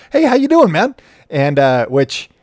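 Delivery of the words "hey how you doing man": high, loud, cheery ballplayer greeting; friendly greeting. Retold or imitated. Player greeting a reporter in the dugout, high and cheery. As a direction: call out hello like a buddy spotting you. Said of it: "and he was like".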